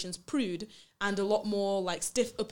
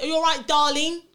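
put-on a slightly higher, louder British charmer doting on a girl; mock British charmer; 6 semitones higher, 7 dB louder than usual. To friends, imitating a British guy being doting, in a mock British accent. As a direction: play the sweet-talking British boyfriend.

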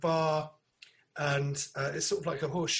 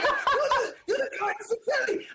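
imitated excited fan; 5 dB louder than usual. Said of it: "he's like".

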